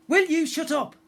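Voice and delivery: high, loud angry snap; snapped. A narrator's reading. Pirate girl snapping at her first mate, loud and high. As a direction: bite the words off at him. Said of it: "the girl's voice snapped".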